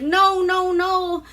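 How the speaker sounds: high, frantic begging, imitated; pleading. Redhead begs the prince to spare the men, high pleading. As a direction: play a redhead begging for the men's lives. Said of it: "she's just like".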